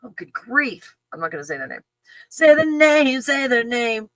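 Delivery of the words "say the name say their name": higher, teasing chant of a demand; mock demand. Playing the audience demanding the gifter's name, mock demand. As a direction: act out a pestering crowd.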